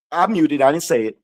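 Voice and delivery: muttered joke. Real, not acted. Joking to viewers he didn't say it, low mutter. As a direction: mumble it like a sly cover-up. Joking cover after the insult.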